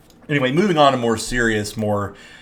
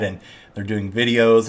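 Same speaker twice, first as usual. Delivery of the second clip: normal talking voice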